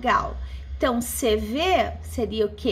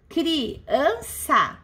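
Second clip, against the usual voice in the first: "criança" slowly split into separate syllables. Shown as a demonstration. Teacher showing class a three-syllable word, syllable by syllable. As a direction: pause between each syllable of "criança".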